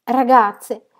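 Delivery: loud, high call; alarmed yell; crying out. A narrator's reading. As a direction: shout it to the whole room, frantic. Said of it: "gridò correndo selvaggiamente alla finestra".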